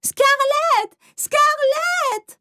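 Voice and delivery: very high, frantic shout of a name; mother yelling for her child across the room; calling out. Acted. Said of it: "Maman est entrée criant mon nom".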